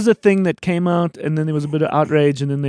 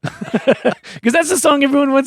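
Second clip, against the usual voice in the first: laughing; 11 semitones higher, 5 dB louder than usual. Real, not acted. Laughing while telling story.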